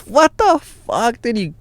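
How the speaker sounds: drawn-out, high-pitched disbelief; incredulous. Retold or imitated. Asked to lend beer money, incredulous. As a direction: stretch it out high in indignant disbelief. Reacting to friend asking for money.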